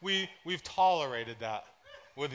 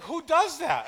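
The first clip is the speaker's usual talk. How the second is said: incredulous wife; 12 semitones higher, 6 dB louder, slower than usual. Retold or imitated. Wife reacting to sock trick.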